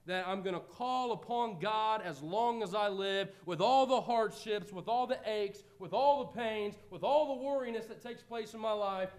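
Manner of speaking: loud, emphatic preaching; full-force preaching. Acted. Preacher urges the congregation to call on God, fervent. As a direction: drive each line home.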